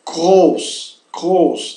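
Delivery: "groß" with throaty R, long O, final s; German pronunciation demo. Shown as a demonstration. Teacher summing up the three sounds, German pronunciation demo. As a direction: say "groß" twice as a careful model. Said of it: "Dizemos, então".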